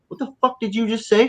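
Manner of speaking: high-pitched indignant challenge; mock confrontation. Fan acting a dealer confronting rude people, high mock outrage.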